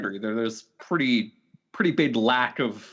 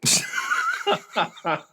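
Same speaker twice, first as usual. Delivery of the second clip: burst of laughter. Real, not acted. Laughing hard at a cohost's absurd skeleton joke.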